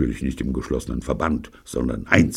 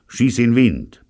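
loud, higher command; brusque loud order; 9 semitones higher, 7 dB louder, slower than usual. A narrator's reading. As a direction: order her out. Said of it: "befiehlt er".

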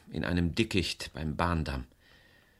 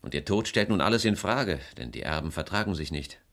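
ordinary delivery

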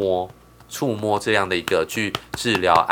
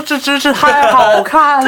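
shouted high and slow with delight; yelling across the room; 9 dB louder, slower than usual. Retold or imitated. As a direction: yell it out from the balcony. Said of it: "还冲去阳台大喊".

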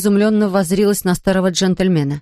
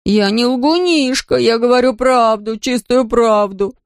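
higher, slow, drawn-out wail; wailing boy; 5 semitones higher, slower than usual. A narrator's reading. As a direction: wail his innocence. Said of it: "завывал Фатти". (завывая, протяжно)

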